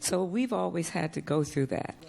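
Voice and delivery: ordinary delivery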